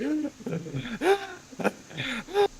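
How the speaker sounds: high, rhythmic mock gasps; teasing impression of breathy pants; imitated heavy panting. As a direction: imitate someone's overdone panting. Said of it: "For us, it's like".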